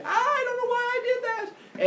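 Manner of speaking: very high wail while fleeing; mock panicked wail. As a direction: wail it out as if running away. Hero flees villagers in panic.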